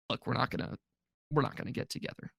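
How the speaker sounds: soft, breathy letdown; hushed breakup line. Retold or imitated. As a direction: break it to him quietly. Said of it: "she cries again".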